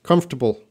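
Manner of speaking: Comfortable as KUMF-ter-bul, three syllables, no middle t; American pronunciation. Shown as a demonstration. Teacher models Comfortable for a student. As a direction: say Comfortable clipped and quick, t dropped. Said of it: "it should be pronounced comfortable".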